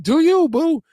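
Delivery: punchline impression of the wife; hammed-up wife voice. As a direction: belt it out as an exaggerated wife impression. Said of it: "She said".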